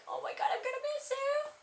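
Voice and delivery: high, quick tearful farewell voice; imitating herself near tears; sad. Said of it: "I was, like, so sad".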